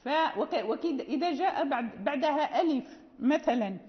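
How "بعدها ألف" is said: ordinary delivery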